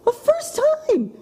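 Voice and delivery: high, wondering voice; emotional. Real, not acted. Host telling guest about her dog, high with wonder. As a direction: lift it up in awe at the memory.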